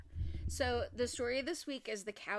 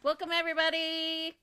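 loud welcome; 7 semitones higher, 10 dB louder than usual. Real, not acted. Host greeting the new listeners, loud welcome. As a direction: call it out big to the whole audience.

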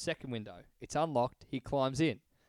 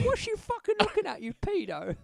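much higher mock Cockney accent; Cockney Queen; 4 dB louder than usual. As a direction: go very high and aggressive as a Cockney queen. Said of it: "did she talk real, like, Cockney".

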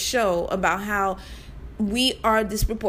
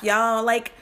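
normal talking voice

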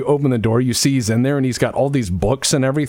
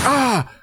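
wizard yelp; 11 semitones higher than usual. Acted. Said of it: "and he's like".

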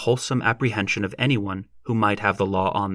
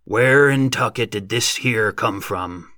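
slow, dumbfounded farmer; drawn-out rustic bewilderment; odd exclamation; slower than usual. A narrator's reading. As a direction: wonder aloud slowly, thunderstruck. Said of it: "exclaimed in an odd voice".

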